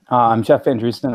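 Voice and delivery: normal talking voice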